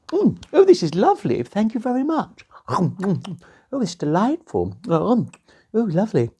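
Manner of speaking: high delighted princess. Acted. Princess eating cake in the kitchen, high and delighted. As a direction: savour each bite like a dainty princess.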